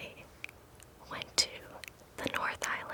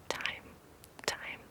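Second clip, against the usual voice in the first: whispered echo; 3 dB quieter than usual. Shown as a demonstration. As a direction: whisper time with a fading echo. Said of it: "echoed trigger word".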